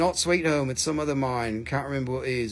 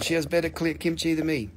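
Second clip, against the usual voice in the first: usual speaking voice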